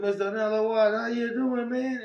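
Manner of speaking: high, teasing fake-friendly hello; mocking greeting. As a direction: greet him sweetly to needle him. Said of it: "I clowned him".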